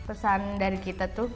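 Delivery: ordinary talk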